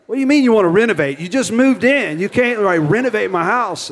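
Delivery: high, fast, loud protest; indignant disbelief. Retold or imitated. Homeowner protesting renovation.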